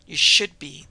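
loud, gruff growl. A narrator's reading. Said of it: "the surgeon growled".

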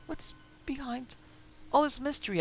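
demanding. Acted. Narrator pressing his friend outside the door, insistent demand. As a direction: press him hard for an answer. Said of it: "I demanded".

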